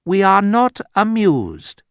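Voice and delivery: high, slow, loud royal disdain; haughty Queen. Retold or imitated. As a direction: deliver it as an offended queen. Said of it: "she said".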